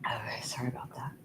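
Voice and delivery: fast whispered apology. Real, not acted. Reader quietly apologizing to viewers, whispered. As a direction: breathe the apology out without voice. After drinking water for hiccups.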